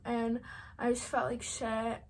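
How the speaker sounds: normal talking voice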